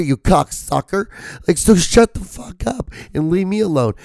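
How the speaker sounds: high, cracking squeal of outrage; indignant high-pitched rant; upset screaming rant. Real, not acted. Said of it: "watching [name] being so upset and really screaming".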